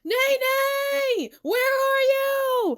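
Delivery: high, long-held calling out; searching shout. A narrator's reading. Tsukasa searching the park for Nene, shouting. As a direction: holler each word out long and high. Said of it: "Tsukasa shouting".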